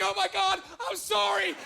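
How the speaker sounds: high, hammed-up panicked apology; 1 freaked-out girl. Date frantically apologizing in a high voice. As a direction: gush a horrified apology at him. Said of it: "She's like".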